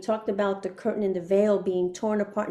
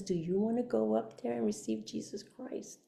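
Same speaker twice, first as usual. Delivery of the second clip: soft, hushed question; gentle invitation; 10 dB quieter than usual. Retold or imitated. Girl turns to invite her forward, gently hushed. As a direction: ask it quietly, as a kind offer.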